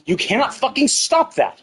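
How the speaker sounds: ordinary talk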